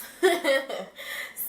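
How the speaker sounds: bright burst of laughter; laughing. Real, not acted. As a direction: laugh out loud at yourself. Laughs at her own joke.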